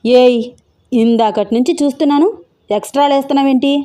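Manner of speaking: put-on annoyed young master snapping at the maid to go; snapping angrily. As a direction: shoo the maid off, rising to a sharp bark. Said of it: "గసిరాడు Venkat".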